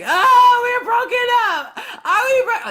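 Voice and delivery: loud, very high crying wail; mock wailing. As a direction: bawl it out, hammed up. Acting out her wailing at breakup.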